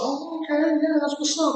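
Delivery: put-on, high-pitched girl voice; imitated bartender girl. Bartender chick approving of a customer's drink knowledge, imitated high girl voice.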